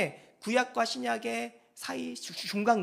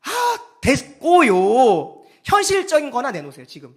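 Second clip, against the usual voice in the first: loud, higher, brushing God off; mock demanding worshipper; brusque dismissal; 3 semitones higher, 12 dB louder than usual. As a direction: cut God off with loud impatience. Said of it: "그들은 이런 식으로 됐어요".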